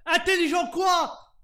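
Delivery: loud, slow, disbelieving; incredulous shout. Acted. Prisoner mocking the interrogator.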